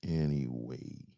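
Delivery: ordinary talk, nothing marked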